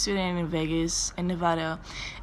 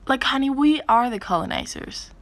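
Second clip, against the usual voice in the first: high, sassy put-down; sassy comeback; 7 semitones higher, 4 dB louder than usual. Retold or imitated. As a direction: talk down to her, sweetly sassy. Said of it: "And I was like".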